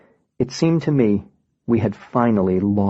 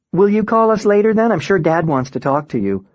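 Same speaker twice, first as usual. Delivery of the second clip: a higher, hopeful mom voice; lightly voiced mother asking him to call back; 8 semitones higher than usual. A narrator's reading. Mom's plea on the phone.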